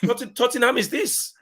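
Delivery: put-on Conte's Italian accent. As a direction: do Conte in a hammed-up Italian accent, pitched high. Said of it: "Terrible accents".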